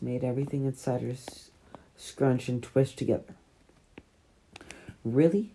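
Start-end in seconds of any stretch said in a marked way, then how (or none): none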